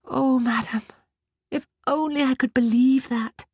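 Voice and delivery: put-on high, crying girl's voice; tearful. A narrator's reading. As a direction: speak through tears. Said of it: "tears in her eyes".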